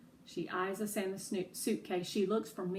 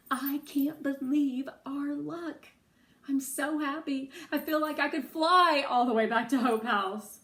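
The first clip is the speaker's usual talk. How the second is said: giddy joy; 5 semitones higher, 6 dB louder than usual. A narrator's reading. Narrator bursting with happiness.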